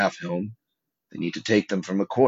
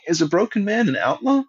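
very high, louder questioning voice; Podrick; 13 semitones higher, 5 dB louder than usual. A narrator's reading. Podrick asking about broken men, high questioning voice. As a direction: ask it high and raised, as a squire.

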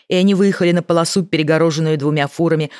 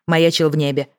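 plain reading voice

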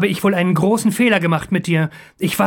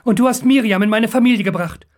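high, urgent apology; anxious pleading, pitched up throughout; desperate confession; 7 semitones higher than usual. A narrator's reading. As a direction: confess while begging for mercy. Captive confesses, begging for his life.

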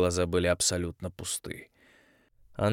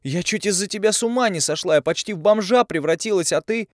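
high, louder, breaking through shock; girl blurting it out, half choked; young woman's shaken outburst; forced-out outburst; 11 semitones higher, 5 dB louder than usual. A narrator's reading. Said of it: "выдавила Тави".

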